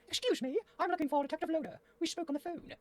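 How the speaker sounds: high falsetto lady client; squeaky high female voice; Miss Green. Acted.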